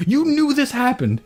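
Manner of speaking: high-pitched outraged question; retold angry disbelief; incredulous dad. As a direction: grill the kid, voice shooting up. Retells incredulous dad questioning son.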